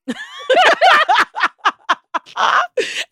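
high burst of teary laughter; loud emotional laugh. Real, not acted. Mom overcome, laughing through emotion.

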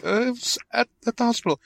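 very high, repeated mock reassurance; high soothing mother. Mother hushes her wailing son, very high mock soothing. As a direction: hush him in a squeaky voice.

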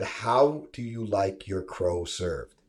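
slow, spelled-out "How do you like your crow served"; drawn-out, deliberate question; slow repeat. Shown as a demonstration. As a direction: repeat it slowly for someone who misheard. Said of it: "I said".

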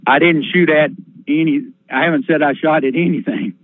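usual speaking voice

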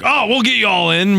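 a light imitation of the upbeat driver; mock cheery driver. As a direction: sell it like an overconfident driver. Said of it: "He's like".

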